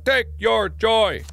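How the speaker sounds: eerie slow chant of the catchphrase; slogan voice. Shown as a demonstration. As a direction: intone each word slowly like propaganda. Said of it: "That's much better".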